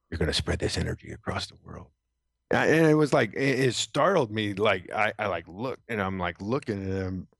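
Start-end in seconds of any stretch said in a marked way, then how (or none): 0.1-1.9 mysterious voice. Retold or imitated. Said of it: "I hear this voice that goes".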